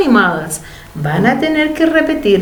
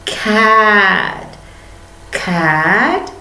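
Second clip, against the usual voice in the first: ordinary delivery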